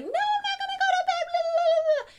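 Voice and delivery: high-pitched bratty refusal; whiny little girl. Retold or imitated. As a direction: whine like a kid refusing bed. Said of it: "And she was bitching".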